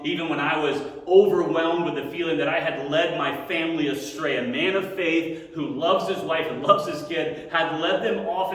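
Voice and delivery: heartfelt pastor's prayer to the congregation; fervent prayer. Acted. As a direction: pray it out with rising conviction. Leads closing prayer.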